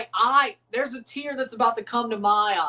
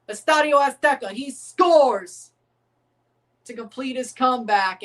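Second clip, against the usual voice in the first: dramatic sports narration; 3 semitones higher than usual. Acted. Streamer narrating Jimenez's comeback to chat, dramatic hype voiceover. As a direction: build his comeback like a documentary trailer.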